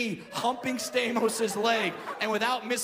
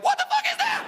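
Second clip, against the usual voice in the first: shrieked high, loud mock horror; a hammed-up fearful shriek; 6 dB louder than usual. Woman afraid of little people, mock shriek. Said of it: "It's more like".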